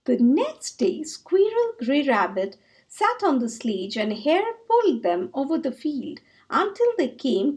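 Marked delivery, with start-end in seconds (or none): none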